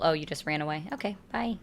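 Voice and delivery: ordinary talk, nothing marked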